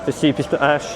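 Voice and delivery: ordinary delivery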